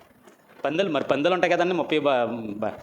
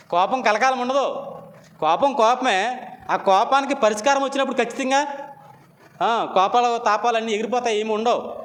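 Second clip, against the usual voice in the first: raised pulpit preaching; full-force preaching; 6 semitones higher than usual. Acted. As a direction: preach it out to the congregation, building.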